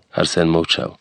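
usual speaking voice

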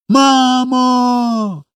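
high, long-held call to mama; child's cry. A narrator's reading. Small boy calls to mama in the sky, crying out. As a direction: call out high, holding the vowel. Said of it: "крикнув Коля".

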